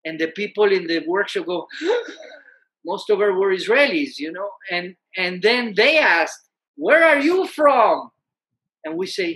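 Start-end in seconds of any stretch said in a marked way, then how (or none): 1.7-2.2 sharp high gasp; shocked gasp. Retold or imitated. Israeli workshop group hearing Iran, shocked gasp. 6.8-8.1 loud, higher, slow question; calling out. Retold or imitated. Iranian newcomers asking the Israelis, loud slow question.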